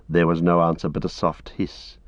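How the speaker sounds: ordinary talk, nothing marked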